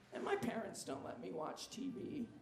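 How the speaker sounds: usual speaking voice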